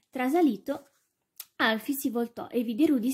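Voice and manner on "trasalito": usual speaking voice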